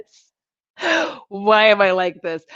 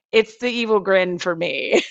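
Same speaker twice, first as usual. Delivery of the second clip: ordinary talk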